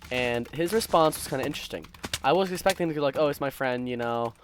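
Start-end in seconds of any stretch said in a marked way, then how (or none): none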